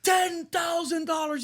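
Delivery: high, loud, drawn-out mock outrage; hammed-up furious sticker shock; going ape shit. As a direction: shriek in disbelief at the price. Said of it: "goes fucking ape shit".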